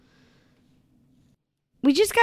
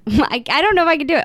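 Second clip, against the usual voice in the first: usual speaking voice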